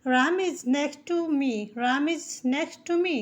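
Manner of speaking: "Ram is next to me" spoken very slowly, word by word; slow dictation pace for learners; slow teaching read. Shown as a demonstration. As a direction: read it out slowly so a child can repeat. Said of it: "तो है को हम English में is लिखते हैं".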